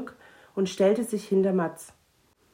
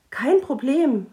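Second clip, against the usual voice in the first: eager; 7 semitones higher, 7 dB louder than usual. Acted. Mathilda eagerly offers protection.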